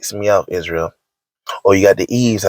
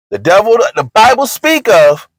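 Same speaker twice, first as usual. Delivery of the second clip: emphatic; 11 semitones higher, 4 dB louder than usual. Acted. Emphatic high outburst.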